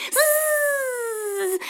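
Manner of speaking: high, soft angry buzzing; bee buzz. A narrator's reading. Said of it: "прожужжала обиженная пчела".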